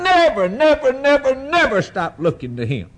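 high, ringing repeated "never", easing toward the end; impassioned exhortation. Real, not acted. Preacher urging friends to keep looking, impassioned exhortation. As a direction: drive each "never" home high and insistent.

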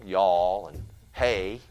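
slow drawled Southern "y'all and hey"; Southern accent. Shown as a demonstration. Preacher demonstrates how kids pick up a Southern accent. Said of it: "you know, Southern".

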